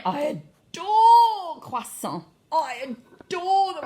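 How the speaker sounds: high, stretched-out swooning; gushing adoration. Acted. Teacher acting out adoring croissants, gushing. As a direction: swoon over it, dragging out "adore".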